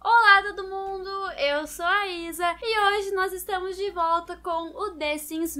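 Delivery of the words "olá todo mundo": bright, loud opener to the viewers; loud cheery greeting. Real, not acted. Vlogger greeting her viewers, loud and cheery. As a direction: call out hello like starting a show. Retake of vlog intro greeting.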